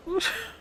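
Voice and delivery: high squeaky giggle; a quick high giggle at herself. Real, not acted. Laughing at her own plan, high giggle.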